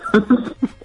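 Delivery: high burst of laughter; hearty relishing laughter. Real, not acted.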